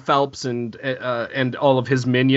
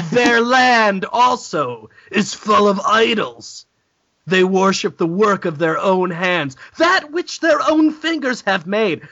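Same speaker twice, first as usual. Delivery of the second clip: mock scripture reading; 3 semitones higher, slower than usual.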